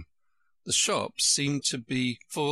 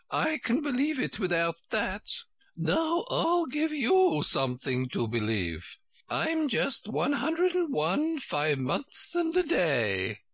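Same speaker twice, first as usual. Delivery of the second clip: high, slow Queen voice; dotty high-pitched Queen; 10 semitones higher than usual. A narrator's reading. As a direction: announce it grandly in a high, drawn-out voice.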